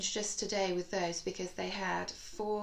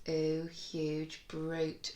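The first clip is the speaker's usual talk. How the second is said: ooh stretched long and slow in ooh huge brute; drawn-out ooh; 2 semitones lower, much slower than usual. Shown as a demonstration. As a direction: lean hard on the ooh, slowly. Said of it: "remember, they will all include our new sound".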